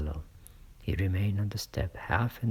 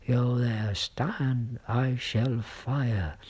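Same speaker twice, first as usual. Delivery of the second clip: loud, higher challenge, then slow; loud threat; 5 semitones higher, 4 dB louder, much slower than usual. A narrator's reading. The guard shouts a warning at the rider in the mist. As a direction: bellow the order, slowing on the last words.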